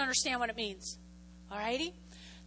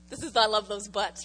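ordinary delivery

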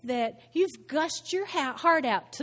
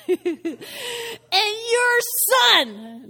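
high, loud, drawn-out accusation; letting loose; 10 semitones higher, 7 dB louder, much slower than usual. Acted. Older son accuses his father, letting loose. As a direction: hurl it out slowly, high and loud. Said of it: "the son just lets loose".

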